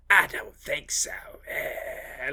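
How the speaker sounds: slow, gravelly hammed-up growl with an odd accent; gravelly mock thunder god, trailing into a croak; Christopher Lambert impression. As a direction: rasp it out slowly in a weird accent. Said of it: "he's got, like, a gravelly voice".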